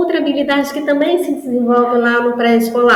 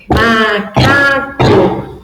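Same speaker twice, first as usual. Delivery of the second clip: very slow and louder, syllable by syllable; clapped syllables; 4 dB louder, much slower than usual. Shown as a demonstration. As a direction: mark each syllable as if clapping. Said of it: "Pode ser batendo na mesa".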